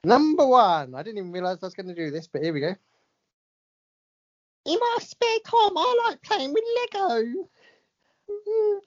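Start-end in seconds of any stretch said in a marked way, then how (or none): 4.7-8.8 David Beckham impression. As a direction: put on a gentle, high Beckham voice. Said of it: "doing impressions of all these people".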